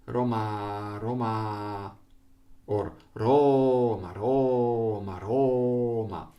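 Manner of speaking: Roma chanted lopsided, stretching MA, then stretching RO; uneven syllable lengths. Shown as a demonstration. Teacher showing the wrong rhythm, Roma with unequal syllable lengths. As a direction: drag out one syllable of Roma, cut the other short. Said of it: "the syllables are not the same length".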